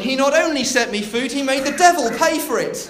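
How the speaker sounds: high, loud triumphant punchline. Acted. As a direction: crow it loudly at the neighbour.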